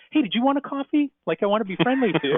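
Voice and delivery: a retold plumber poking his head back in with a falsely friendly tone; suddenly friendly plumber. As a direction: switch to sweet hospitality after the threat.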